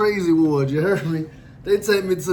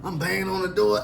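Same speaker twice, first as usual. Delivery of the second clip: ordinary talk, nothing marked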